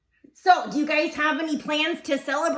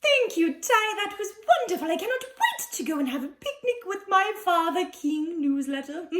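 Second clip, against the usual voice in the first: gushing hostess. Acted.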